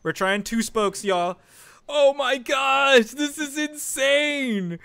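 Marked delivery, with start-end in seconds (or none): 1.9-4.8 high, stretched-out wail of disbelief; exasperated high holler; overwhelmed cry. Real, not acted. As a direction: cry it out slowly, high and loud. Overwhelmed reaction to gameplay.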